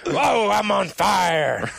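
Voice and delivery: very slow, loud, high hammed shout; announcer shout. Imitating the game voice for friends, with a slow, loud, hammed shout. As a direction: stretch it out like an arena announcer. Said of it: "And then you hear".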